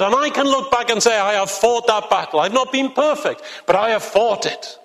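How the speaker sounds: rising, fervent pulpit delivery; preaching at full force. Acted. Preacher rousing the congregation about the spiritual battle, fervent. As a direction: build it like a call to battle.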